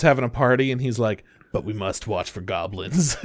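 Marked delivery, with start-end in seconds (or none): 1.5-3.2 low, soft, deadly serious voice; mock stoic hero. Hero on watch while everyone parties, mock-solemn. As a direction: play the grimly vigilant hero, hushed.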